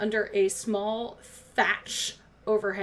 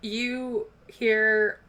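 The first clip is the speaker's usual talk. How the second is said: normal talking voice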